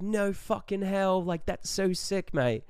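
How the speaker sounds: high, slow put-on Australian awe; Australian accent. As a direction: overplay being impressed as an Australian. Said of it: "just saying mate left and right".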